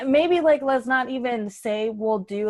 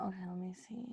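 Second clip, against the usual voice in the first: whisper; 2 semitones lower, 18 dB quieter, much faster than usual. Real, not acted. Thinking to himself.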